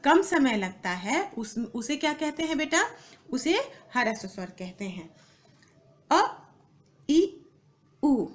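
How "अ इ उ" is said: अ, इ, उ each said briefly; short vowels. Shown as a demonstration. Teacher showing students quick vowels, short अ, इ, उ. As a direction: cut अ, इ, उ short, pausing between them. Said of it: "इसमें बहुत कम समय लगता है".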